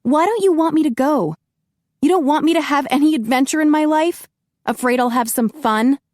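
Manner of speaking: angry accusation to Grigori; accusing. Acted. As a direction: throw the accusation at him. Heroine angrily accusing him.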